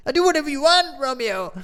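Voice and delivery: high, loud pleading cry; desperate outburst. Acted. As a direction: blurt it out, begging. Benvolio blurts it out.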